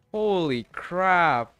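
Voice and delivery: ordinary talk, nothing marked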